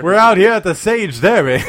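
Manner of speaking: loud excited race caller; race announcer. Acted. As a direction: call it like a horse race. Mock race commentary.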